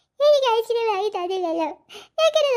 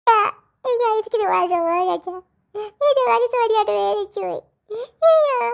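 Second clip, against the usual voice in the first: mock sobbing; 4 semitones higher, 4 dB louder than usual. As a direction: fake sobs ending in a loud, higher wail. Child still crying about ice cream.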